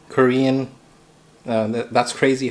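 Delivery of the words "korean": normal talking voice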